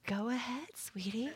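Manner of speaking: very soft, gentle voicing; sweet old lady. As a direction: coax softly, like a kindly old lady. Little old lady waving them on.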